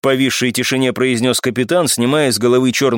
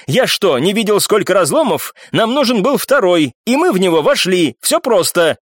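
higher-pitched, heated retort; indignant exclaiming; 6 semitones higher than usual. A narrator's reading. Данцев defending his choice of rift, indignant. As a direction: insist indignantly. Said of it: "ещё громче вскричал Данцев".

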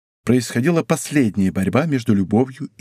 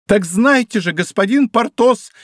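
higher, louder indignant cry, slow; exclaiming; 9 semitones higher, 4 dB louder than usual. A narrator's reading. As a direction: fling it at him, stung. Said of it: "вскричала она". (вскрикнуть с обидой, выше и громче)

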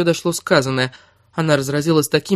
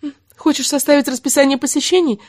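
higher, acid mock question; sarcastic jab; 9 semitones higher than usual. A narrator's reading. Kira needles Erik sarcastically, higher. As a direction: needle him to hold back tears. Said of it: "принялась язвить".

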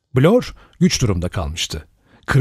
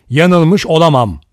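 firm, louder, higher denial; harshly; 5 semitones higher, 6 dB louder than usual. A narrator's reading. Armstrong harshly insists he couldn't be wrong, louder. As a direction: shut him down hard. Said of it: "sertçe".

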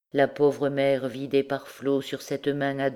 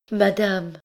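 higher-pitched desperate cry; higher, pleading call; 5 semitones higher, 3 dB louder than usual. A narrator's reading. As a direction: cry out to her, begging. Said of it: "cria-t-elle".

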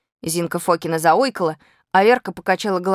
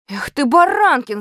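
loud, raised-pitch yell; narrated yell; 6 semitones higher, 5 dB louder than usual. A narrator's reading. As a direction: yell reproachfully at a classmate. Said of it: "заорал на меня Венька Смирнов".

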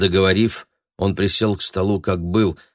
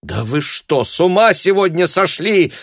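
loud, high, losing patience; shouting back; 11 semitones higher, 5 dB louder than usual. A narrator's reading. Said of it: "закричала в свою очередь и Таня".

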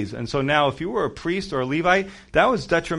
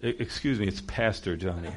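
mock snooty correction; 8 semitones lower, 7 dB quieter than usual. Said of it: "how nasty does that sound".

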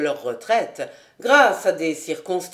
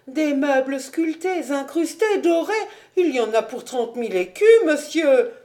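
higher, fast listing with nervous laughter; flustered protest; 5 semitones higher, 3 dB louder than usual. A narrator's reading. The financier lists his furniture to the captain, higher and fast. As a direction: rattle off his treasures in a panic. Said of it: "le financier se montra rétif".

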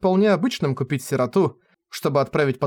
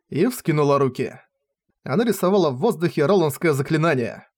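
ordinary delivery, nothing marked

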